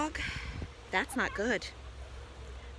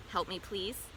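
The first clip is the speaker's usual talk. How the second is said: plain reading voice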